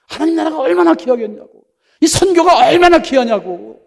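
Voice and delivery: fervent rising insistence; impassioned preaching. Real, not acted. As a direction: stretch 얼마나 with fervent emphasis. Preacher exclaims how precious it is.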